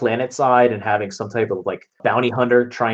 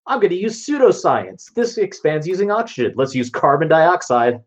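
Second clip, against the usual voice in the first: mock know-it-all; 7 semitones higher than usual. Friend mocks Rob's fake science.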